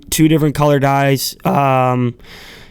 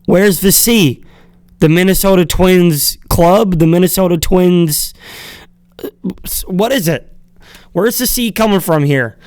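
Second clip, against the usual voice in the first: mock annoyed; 4 semitones higher than usual. Real, not acted. Fan rants about the Twins logo, playfully exasperated. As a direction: demand an answer in playful exasperation. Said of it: "I wanna stay annoyed".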